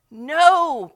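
a loud, emphatic refusal. A narrator's reading. Peter insists to Jesus, a loud emphatic no. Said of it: "Peter declared emphatically".

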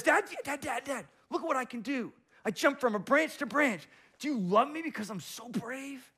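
put-on high, eager child voice begging dad; excited little kid asking for praise; little squirrel child. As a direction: play the small squirrel showing off.

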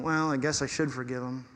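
low, soft, grudging concession; mock a reluctant forgiver, voice dropped; begrudging. Preacher voicing a God who forgives grudgingly, begrudging. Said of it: "to meet us begrudgingly".

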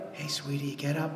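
put-on coaxing a sleeping child awake, hushed; tender wake-up call. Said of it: "It's so tender and it's loving".

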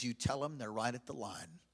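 low, hushed, solemn voice; soft and low, as if relaying God's words; God speaking. Retold or imitated. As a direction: deliver a quiet, grave warning. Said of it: "God speaks to me as clear".

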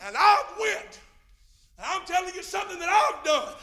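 loud, high, driving preaching; preacher urging the church to rescue sinners, shouting; preaching at full force. Real, not acted. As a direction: build the congregation up.

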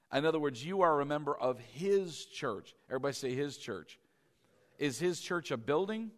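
ordinary talk

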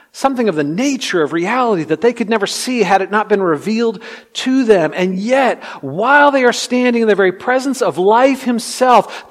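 high, loud sermon climax; fervent pulpit delivery; impassioned preaching. Real, not acted. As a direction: preach it with rising force, landing hard on key words. Preaches at full force.

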